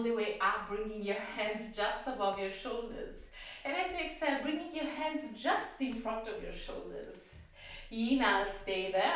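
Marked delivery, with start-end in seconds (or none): 3.6-9.1 breathless, winded instructor; talking while out of breath. Real, not acted. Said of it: "I'm a little bit out of breath".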